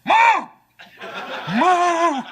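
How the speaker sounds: loud, high crazed moo; deranged cow, played for laughs; mad cow mooing. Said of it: "Any cow walking around going".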